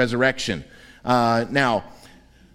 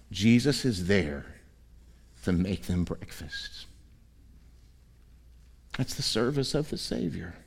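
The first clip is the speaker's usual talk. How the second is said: tender close; 6 semitones lower, 8 dB quieter than usual. Real, not acted. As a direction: let it land gently. Sermon's tender climax.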